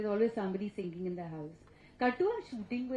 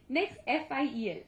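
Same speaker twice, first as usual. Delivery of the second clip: ordinary talk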